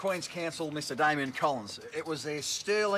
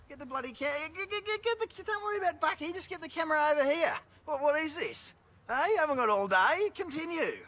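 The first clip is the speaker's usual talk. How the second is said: mock shooing; 11 semitones higher than usual. Chopper shooing the fan off camera, high mock-angry shout. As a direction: bark the fan away in jest, pitched way up.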